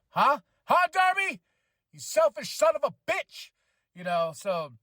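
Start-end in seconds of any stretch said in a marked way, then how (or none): 0.1-3.5 high, loud mock accusation; mock outrage. Fan scolding Darby for ignoring his friend, loud mock anger. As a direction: yell at him in fake fury.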